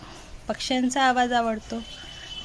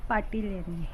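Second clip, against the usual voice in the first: ordinary talk, nothing marked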